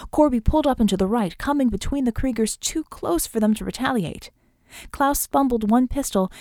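plain reading voice

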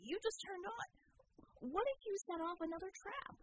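high, slow panicked voice; panicked kid. A narrator's reading. Acrobat panicking at lights.

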